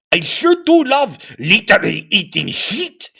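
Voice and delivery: put-on professor in a lightly done German accent; thick German accent. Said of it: "the professor remarked in a thick German accent".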